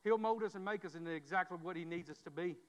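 usual speaking voice

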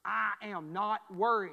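slow, loud, mock-indignant protest; hammed-up insistence, word by word; indignant denial. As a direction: give the mother's stubborn denial. Mother denies she is worried.

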